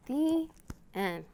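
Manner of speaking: gentle picture-book read-aloud; storytime reading. A narrator's reading. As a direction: read it slowly to little listeners.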